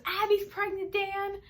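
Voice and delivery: high, loud mock mom blurting the news; angry mom on the phone. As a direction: imitate mom ranting to dad. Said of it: "she calls my dad and says".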